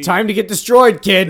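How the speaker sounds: hammed-up villainous boasting; Gaston. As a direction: boom it like a cartoon show-off. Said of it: "now he's gotta be the braggart Gaston".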